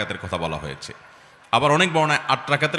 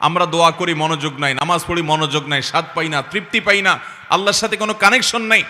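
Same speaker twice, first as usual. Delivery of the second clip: exhorting preaching; 5 semitones higher, 5 dB louder than usual. Acted. Preacher lamenting prayer without focus, mounting exhortation. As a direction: list building higher and louder.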